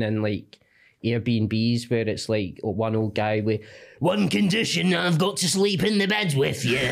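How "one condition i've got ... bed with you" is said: put-on higher, laughing creepy old guy voice; creepy old landlord. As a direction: voice the creepy host. Old Airbnb host quoted.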